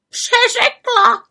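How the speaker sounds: very high, slow, loud squawk; raven croak. A narrator's reading. Raven mocking the witch's slip, scornful croak. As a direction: croak her excuse back scornfully. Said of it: "krákal havran Abraxas".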